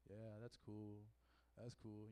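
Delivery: flat unimpressed mumble; bored. Retold or imitated. Bored listeners reacting.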